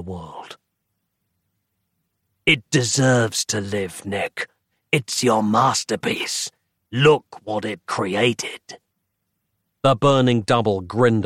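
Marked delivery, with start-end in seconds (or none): 2.5-8.8 slow, seductive demon voice; slow tempting rasp. Acted. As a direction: coax him slowly. Demon coaxes Nick.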